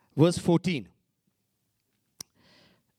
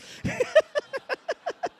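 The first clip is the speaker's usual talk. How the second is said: squeaky high laugh at his own joke; tickled laugh; high laugh burst; 3 dB louder than usual. Real, not acted. Preacher laughs at own story.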